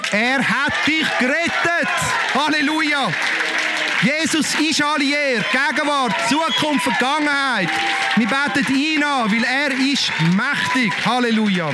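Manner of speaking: high, ringing worship preaching; fervent preacher calling out praise; preaching at full force. Acted. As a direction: lift the congregation up in praise.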